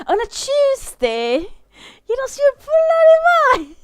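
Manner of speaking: loud, high hammed-up British accent; over-the-top British indignation, building in pitch; mock British outrage. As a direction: shriek it like a scandalised Brit. Mock British outrage, then laughing.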